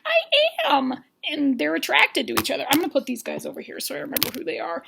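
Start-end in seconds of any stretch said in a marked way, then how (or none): 0.1-0.9 very high, drawn-out reply; mock gushing. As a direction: squeak it like a smitten contestant. Retold contestant's gushing reply.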